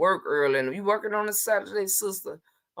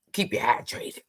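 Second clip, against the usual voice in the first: whispered aside. Real, not acted. Aside to a viewer.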